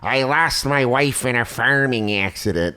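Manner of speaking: put-on slow caricature of a weathered old-timer; grizzled old man. Grizzled Wisconsin man a week later, slow old-timer voice. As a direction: draw it out like an aged farmer. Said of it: "grizzled old men".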